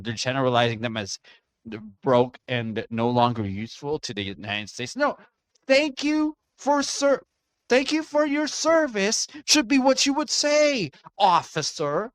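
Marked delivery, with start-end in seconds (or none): 5.0-12.1 high, strained indignation; indignant. Real, not acted. Streamer scolding officer over veteran's treatment, indignant. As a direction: lecture them in a raised, high pitch.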